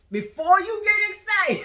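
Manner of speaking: loud, high, exasperated mimicry; mocking repeat. Girlfriend mocking boyfriend's warning, exasperated. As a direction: throw his words back, high and loud.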